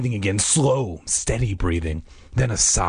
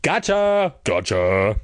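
triumphant taunting; much slower than usual. A narrator's reading. Boy mocking the girls he fooled, triumphant. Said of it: "his triumphant laughter".

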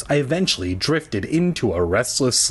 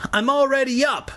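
understated shout, pitched way up; shouting back; 13 semitones higher than usual. A narrator's reading. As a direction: call back in a much higher voice. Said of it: "I shouted".

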